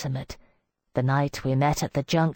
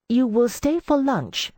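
firm, raised command; ordering; 8 semitones higher, 3 dB louder than usual. A narrator's reading. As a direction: insist he stays, loud and high. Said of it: "I ordered".

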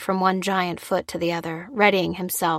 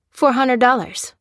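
higher, louder curt price quote; name the price firmly, falling at the end; stern woman behind the counter; stern seller; 6 semitones higher, 5 dB louder than usual. Acted.

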